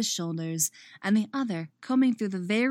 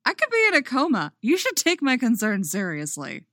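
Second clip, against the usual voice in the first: higher, louder, fast hammed-up protest; mock outrage; 6 semitones higher, 6 dB louder than usual. A narrator's reading. Eddie teasing giggling Chrissy, loud mock outrage. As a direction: overplay the wounded worry for laughs. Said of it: "thick mock outrage in his voice".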